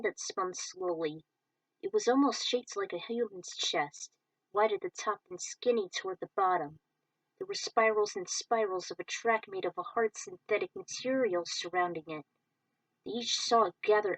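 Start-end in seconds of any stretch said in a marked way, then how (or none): none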